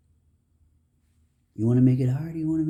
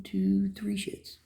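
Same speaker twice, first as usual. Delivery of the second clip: whispered; 8 semitones higher, much slower than usual. Real, not acted. Scoffing at worldly worries.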